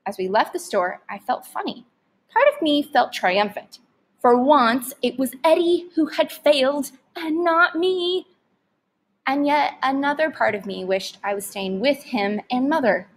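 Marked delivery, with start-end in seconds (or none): none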